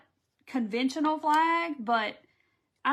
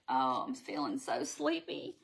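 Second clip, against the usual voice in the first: low, drowsy mock sleepy voice; 10 semitones lower, 4 dB quieter than usual. Recalling feeling full on the couch, low sleepy mock moan. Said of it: "I was like".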